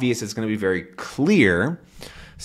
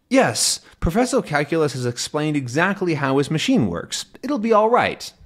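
put-on much higher, confident young voice; Tintin reassuring; 6 semitones higher than usual. Tintin reassuring Haddock before diving, confident. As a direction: sound sure of himself.

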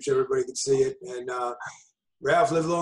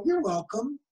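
lightly flirty, higher assistant voice; flirty Alexa; 9 semitones higher, slower than usual. Pretend. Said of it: "I want her to go".

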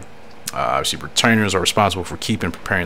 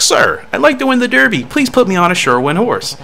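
much higher-pitched jockey voice; jockey character voice; 14 semitones higher, 4 dB louder than usual. Acted. Jockey begging the trainer for a horse, high character voice. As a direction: play the eager jockey up high. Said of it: "That's how my character looks like he talks".

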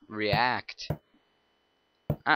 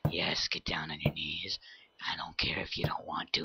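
whispered killer threat, low and soft; menacing whisper; 7 semitones lower, 10 dB quieter than usual. Acted. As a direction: breathe it into their ear like a killer.

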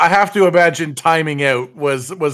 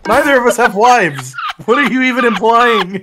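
loud, high-pitched indignation; baffled protest; 6 semitones higher, 6 dB louder than usual. Real, not acted. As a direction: object loudly to the absurd suggestion. Baffled protest at absurd suggestion.

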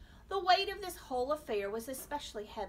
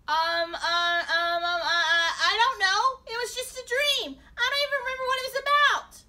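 high, loud, panicky stammering; flustered denial; 12 semitones higher, 17 dB louder than usual. A narrator's reading. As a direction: scramble for an excuse in alarm. Flustered boy covering up his dream.

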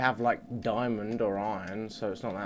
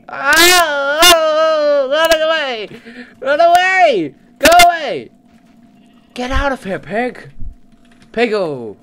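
high, loud, screeching panic; panicked shrieking; 17 dB louder, slower than usual. Real, not acted. Running from a mob, high panicked shriek. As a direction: shriek while fleeing. Fleeing creature in game.

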